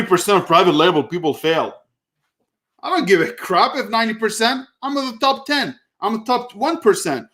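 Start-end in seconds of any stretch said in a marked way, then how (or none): 2.8-7.3 high, fired-up bragging; proudly rising protest; defiant boast. Real, not acted. Boasting he is top seller.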